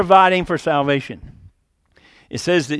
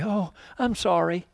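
a soft, hollow "I'm sorry", put on and insincere; lightly mocking sentimental sorry; mock sympathy; 8 dB quieter than usual. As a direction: toss off a hammed-up empty apology.

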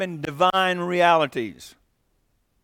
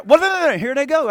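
higher and louder, hammed-up jumping in; mock interrupting; 9 semitones higher, 7 dB louder than usual. Preacher imitates his family interrupting a storyteller, mock eager. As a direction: cut in eagerly like someone who can't wait their turn. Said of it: "and they say".

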